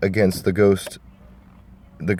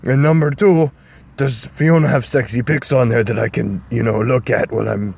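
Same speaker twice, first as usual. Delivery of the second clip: Shrek voice; 3 semitones higher than usual. Acted. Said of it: "Shrek was like".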